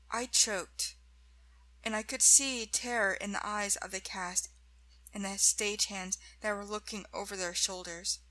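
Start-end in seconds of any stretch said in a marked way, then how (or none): none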